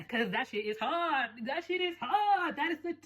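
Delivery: high, slow, drawn-out swoon; gushing hype. Real, not acted. Urging Indian-accented viewers to lean in, high drawn-out gushing.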